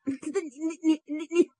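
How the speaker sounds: helpless repeated stammering; stuttering. A narrator's reading. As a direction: stick on each syllable without getting further. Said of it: "后边照样结巴".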